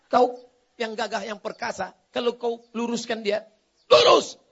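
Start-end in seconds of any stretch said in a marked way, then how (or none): 3.9-4.4 sudden high, loud burst; forceful shout. Acted. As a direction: punch the word out loud and high. Acts out forcing it straight. (teriakan tegas mendadak)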